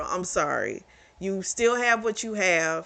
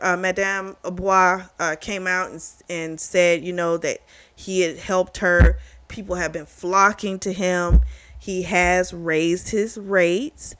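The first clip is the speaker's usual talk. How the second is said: usual speaking voice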